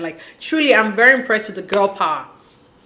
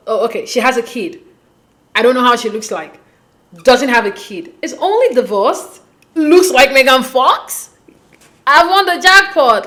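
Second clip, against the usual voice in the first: mock elation; 3 semitones higher, 5 dB louder than usual. Voicing his thoughts on seeing she's a Megan Fox lookalike, mock elation. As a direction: tick off her good points, ever more thrilled. Imagining his delighted reaction to her looks.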